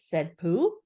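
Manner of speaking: plain reading voice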